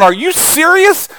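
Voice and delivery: high, slow, incredulous; God's disbelief. Retold or imitated. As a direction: drag out the disbelief. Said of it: "God's up there going".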